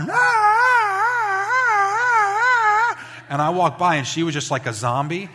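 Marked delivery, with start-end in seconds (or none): 0.0-3.0 put-on long high wailing child's cry, loud; loud drawn-out screaming cries of a kid. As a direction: wail like a toddler mid-tantrum. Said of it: "the kid's going".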